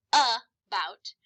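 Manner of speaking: "About" split as uh, bout; schwa pronunciation. Shown as a demonstration. Teacher repeating correction, "About" with schwa. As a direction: begin "About" on a short uh, then separate bout. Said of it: "my A is making a schwa sound".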